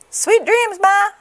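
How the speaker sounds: high, lively gosling good night; baby goose. A narrator's reading. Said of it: "said the baby goose".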